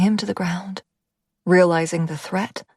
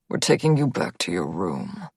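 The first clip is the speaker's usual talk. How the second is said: a low, firm male guard voice; lightly voiced stern guard; 12 semitones lower, 4 dB quieter than usual. A narrator's reading. Guard Vinny speaks.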